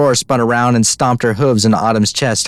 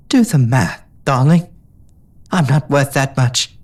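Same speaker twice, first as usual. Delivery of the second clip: tender; 4 semitones higher, slower than usual. A narrator's reading. Tender resignation.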